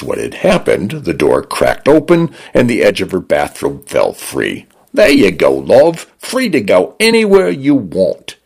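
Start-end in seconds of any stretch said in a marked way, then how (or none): 4.9-8.3 cheeky Cockney condo voice, pitched up; the home AI speaks in a breezy Cockney lilt; Cockney male voice. A narrator's reading. Smart home greets its owner in chirpy Cockney.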